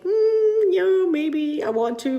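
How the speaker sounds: high, loud hum then a coy maybe; sing-song undecided shrug of a yes; playful maybe. Shown as a demonstration. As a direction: tease the idea of wanting to. Voicing a tentative maybe.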